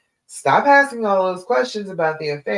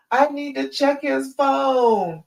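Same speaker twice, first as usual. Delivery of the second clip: a higher, slow, mild mock of anxious fear; imitating fear's voice; 8 semitones higher, slower than usual. Coach mimicking fear urging her to check his phone, higher and slow. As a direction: lightly play the nagging fearful urge. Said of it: "If the fear and the pain holler".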